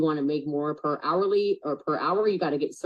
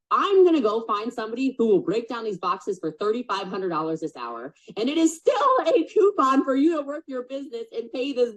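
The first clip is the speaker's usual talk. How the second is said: mocking friend lecturing; 8 semitones higher than usual. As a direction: impersonate the friend hammering the point. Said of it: "He goes".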